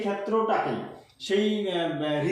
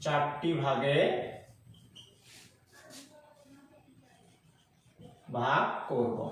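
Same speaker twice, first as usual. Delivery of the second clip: low, soft, drawn-out words with long pauses; slow dictation; 4 semitones lower, 6 dB quieter, much slower than usual. Shown as a demonstration. Teacher dictating the four-part division, slow dictation. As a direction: dictate quietly, a phrase at a time.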